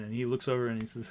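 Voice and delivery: ordinary talk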